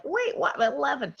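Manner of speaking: high-pitched baffled "wait, what?"; mock disbelief. Retells her reaction to father's speech.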